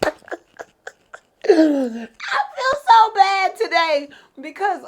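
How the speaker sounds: high, loud, laughing confession; real laughing. Real, not acted. Woman confesses guilt to viewers over the memory, laughing hard. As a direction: squeal it out through laughter.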